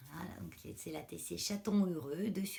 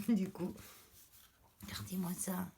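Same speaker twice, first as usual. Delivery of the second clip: ordinary talk, nothing marked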